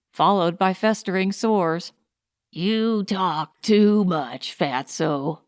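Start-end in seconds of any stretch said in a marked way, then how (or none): 2.5-5.4 slow, cold anger; angry. A narrator's reading. The tall man tells Fatso to shut up, slow and angry. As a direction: drag the words out, angry and contemptuous. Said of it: "the tall one said, angrily".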